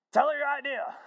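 loud, high, excited urging; retold eager prompting. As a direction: egg him on as eager Harry. Harry eagerly prompts Tony to speak.

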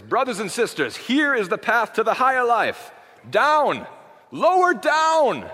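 loud, high-pitched South African preacher proclamation; old Andrew Murray. Acted. Pastor exhorting brothers and sisters, in a loud South African accent. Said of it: "do this in a South African accent".

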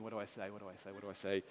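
very soft, frantic thinking aloud; panicked inner voice. Retold or imitated. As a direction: murmur it in a panic. Panicked thoughts at police stop.

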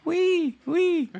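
high squealing wee; pig squeal. Pretend. Drummer does his pig sound on request. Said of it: "here's my pig sound".

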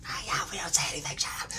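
fast, urgent acted whisper; hushing whisper. Hidden friend demanding silence, imitated quick whisper. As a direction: whisper to silence your accomplice.

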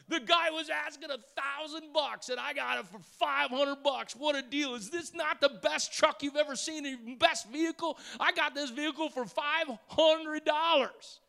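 high, gloating braggart with a laugh; bragging buyer. Acted. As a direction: gloat about the bargain, pitched high. Buyer bragging after deal.

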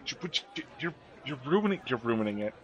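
soft, urgent confidential scolding; leaning-in aside. Acted. Mother leaning in to scold her partner, hushed. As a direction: reproach under your breath. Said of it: "lean in and go".

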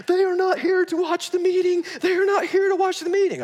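high, loud alarmed warning; panicked pastor. Retold or imitated. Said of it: "He goes".

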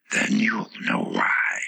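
very slow, whispery flower voice; instructing Tiger Lily. A narrator's reading. The Tiger Lily instructs Alice, slow and whispery. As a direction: instruct slowly, mostly breath.

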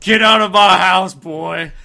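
put-on furious yell at the boy; angry father shout. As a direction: throw him out of the house. Mock angry father line.

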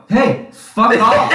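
high, slow, laughing "fuck off"; laughing retort. Retold or imitated. Quoting his reply to his dad, laughing. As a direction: toss it back while cracking up. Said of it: "I go".

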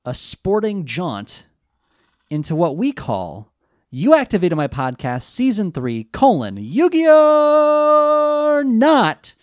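pompous announcer intro; grand announcer. Acted.

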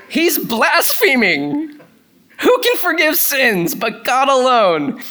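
high, slow, shrieking mock outrage of a self-righteous critic; outraged religious spirit. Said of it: "impersonation of a, of a religious spirit".

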